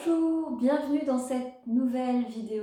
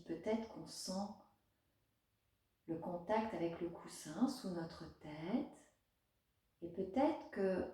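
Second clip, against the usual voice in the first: guided meditation; 4 dB quieter than usual. Acted. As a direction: guide a child gently through a body scan, unhurried.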